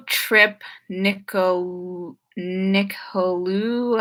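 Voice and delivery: "Nicolu" sounded out very slowly, unsurely; butchered name. Real, not acted. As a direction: stumble through "Nicol-, Nicolu-", drawn out. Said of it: "sorry, I butchered that".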